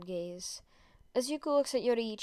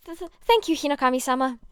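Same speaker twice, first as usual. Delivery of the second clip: higher, louder stammering squeak; 4 semitones higher, 8 dB louder, faster than usual. Acted. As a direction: stutter your thanks, starstruck. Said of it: "he squeaks".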